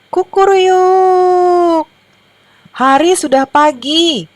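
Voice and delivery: high, drawn-out cock-a-doodle-doo call; loud rooster crow. A narrator's reading. Rooster wakes the hens from the fence, crowing loudly. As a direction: crow out the morning news, pitched high and slow. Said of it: "Kokoknya keras-keras".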